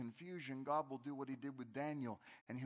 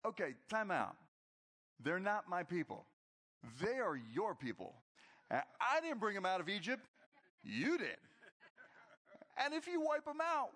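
bold Moses; 8 semitones higher, 3 dB louder than usual. Acted. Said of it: "And Moses goes".

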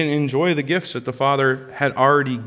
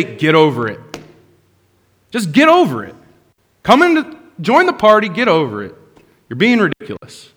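dismissive scolding; 3 semitones higher, 4 dB louder than usual. Pretend. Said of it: "he doesn't say".

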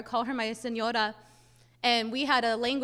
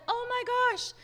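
high, startled gasp of a realization; suddenly remembering, embarrassed; surprised neighbor; 7 semitones higher than usual. Retold or imitated. Neighbor telling mom she forgot to tell her, surprised. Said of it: "And she's like".

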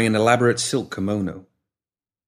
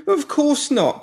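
desperate; slower than usual. A narrator's reading. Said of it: "a tinge of desperation".

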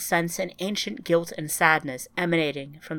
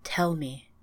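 softer, hushed plea; soft request; 5 dB quieter than usual. Acted. Ryan quietly urging the grieving Savannah, softly. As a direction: coax it out of her gently. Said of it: "she whispered".

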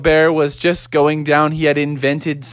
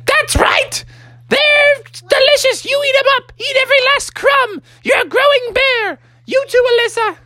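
very high falsetto mama voice; emphatic Mother Bear. Acted. Mother Bear making children eat up, high emphatic falsetto. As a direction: command them to eat in bossy falsetto.

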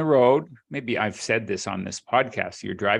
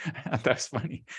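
laughing; 7 dB quieter, faster than usual. Real, not acted. Repeats joke through a laugh.

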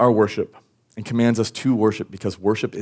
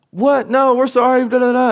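very high, loud panicked protest; pleading kid; 13 semitones higher, 5 dB louder than usual. Retold or imitated. Child learns ice skating is off, high pleading protest. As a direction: imitate a child frantically begging and apologising.

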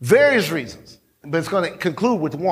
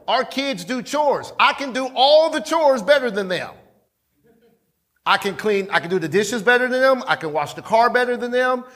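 normal talking voice

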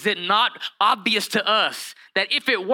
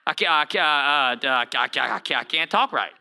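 Moses stuttering; 5 semitones lower than usual. Retold or imitated. Preacher acting out Moses refusing God, stuttering. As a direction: trip over every word like Porky Pig. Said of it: "He was stuttering".

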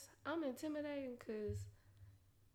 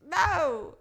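higher and louder fired-up refusal; heated retort; 10 semitones higher, 11 dB louder, slower than usual. Retold or imitated. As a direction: tell Cleotis off, high and loud.

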